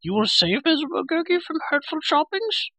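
very high creature wail; crying-out Gurgi. A narrator's reading. Creature begging to be saved from choppings, wailing. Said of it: "Gurgi cried".

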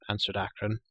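ordinary delivery, nothing marked

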